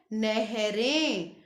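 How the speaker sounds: नहरें said very slowly, nasal ending clearly drawn out; slow nasal plural. Shown as a demonstration. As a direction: stretch नहरें so the nasal ें rings. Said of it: "अब बिंदी कहाँ सुनाई दे रही है हमें?". (नहरें धीरे-धीरे, अनुनासिक साफ़ सुनाते हुए)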